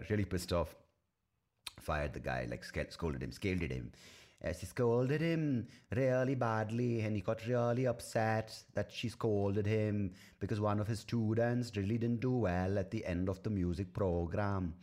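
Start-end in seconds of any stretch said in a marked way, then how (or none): 4.4-14.7 slow, high attempted Welsh accent; wholehearted try at a Welsh lilt. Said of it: "That is the attempted Welsh accent".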